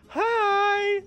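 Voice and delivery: high, loud sing-song "Hi."; old man's greeting. Retold or imitated. Man peeking under the stall greets the teller, high mock hello. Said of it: "he's like".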